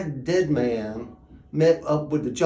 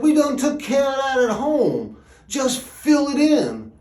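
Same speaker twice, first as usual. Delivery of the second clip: acted brusque reply, pitched up; brusque brothers; 10 semitones higher, 6 dB louder than usual. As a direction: brush off the question loudly. Brothers brush off gravedigger's question.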